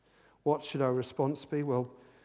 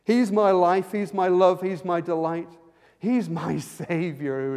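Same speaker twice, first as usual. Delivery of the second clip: higher and louder, rising in joy; exultant preaching; 5 semitones higher, 12 dB louder than usual. Acted. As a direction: proclaim each line with lifted voice.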